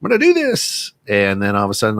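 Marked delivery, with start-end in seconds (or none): 0.0-0.9 high-pitched, loud yeehaw; mock-jubilant whoop, pitched way up; excited. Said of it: "I was very excited".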